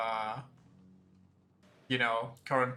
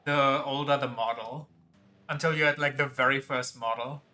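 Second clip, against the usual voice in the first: ordinary talk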